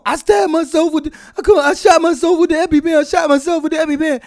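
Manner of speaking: high, fast frantic babble; imitated panic. Husband imitating wife blurting out her accident, high fast mock panic. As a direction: gabble it high, mimicking panic. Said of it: "and panicking".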